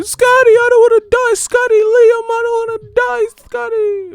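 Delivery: very high, loud falsetto plea; mock pleading. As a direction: cry out like a desperate damsel.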